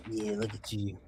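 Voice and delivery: soft, low ogling murmur; Gary. Retold or imitated. Podcaster imitates someone zooming in, hushed ogling voice. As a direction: murmur it privately, admiring the screen. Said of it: "someone like Gary would zoom that up and be like".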